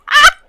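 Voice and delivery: high, loud squeal of delight; delighted shriek. Real, not acted. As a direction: shriek with joy at a great line. Delighted shriek at Darren's line.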